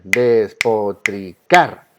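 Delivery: despotricar slowly in syllables, CAR strongest; stress on last syllable. Shown as a demonstration. Teacher telling students to listen, slow despotricar stressed on CAR.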